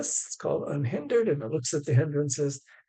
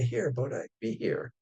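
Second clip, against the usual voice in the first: ever so gently; 3 semitones lower than usual. Retold or imitated. Gently calling the puppy Bodhi back to the newspapers. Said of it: "ever so gently say".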